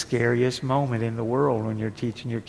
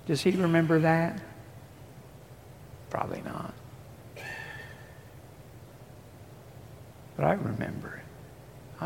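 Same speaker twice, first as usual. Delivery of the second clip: hushed, softer first-person voice of God; God speaking softly; 5 semitones higher, 8 dB quieter than usual. Acted. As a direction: speak as God, quietly and close. Preacher voicing God softly.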